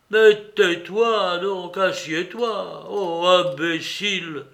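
slow, loud, exasperated scolding; vexed. A narrator's reading. Grouchenka tells Mitya to sit down, vexed. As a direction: scold him with weary annoyance. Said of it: "dit avec dépit Glouchenka".

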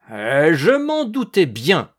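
loud, high, drawn-out cry; old man's cry. A narrator's reading. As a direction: shout it in angry triumph. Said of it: "cria le vieux".

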